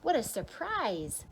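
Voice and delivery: plain reading voice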